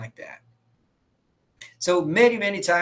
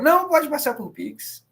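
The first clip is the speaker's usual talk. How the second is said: high-pitched acted reply of a car watcher; playful, insistent reassurance; eager insistence; 8 semitones higher than usual. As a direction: jump up in pitch on the brush-off. Acts car watcher insisting on Pix.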